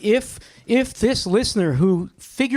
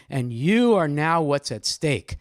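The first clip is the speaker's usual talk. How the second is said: ordinary talk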